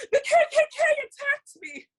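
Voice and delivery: very high, loud whiny cry; mock fake victim. Hypothetical parody for Yasmin, very high loud whine. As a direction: overplay the false accusation. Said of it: "and I go".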